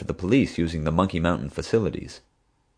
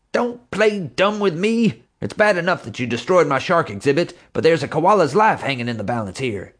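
snapping in rage; 10 semitones higher, 3 dB louder than usual. A narrator's reading. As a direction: explode at him. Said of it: "J J snapped".

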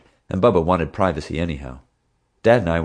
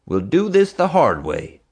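stern cop voice, pitched up; warning tough cop; 5 semitones higher, 4 dB louder than usual. A narrator's reading. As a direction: lean in and warn him hard. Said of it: "he warned Hank".

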